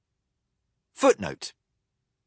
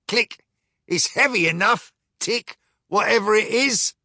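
higher clicking robot voice; clockwork first mate; 9 semitones higher than usual. Acted. Clockwork mate hauls the chest, higher mechanical voice. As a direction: tick and click like a wind-up toy.

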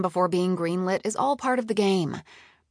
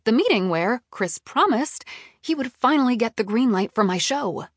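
plain reading voice

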